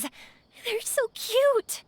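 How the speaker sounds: high, drawn-out squeal of delight; gushing delight. Real, not acted. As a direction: stretch it out, melting over something cute. Gushing over cute carvings.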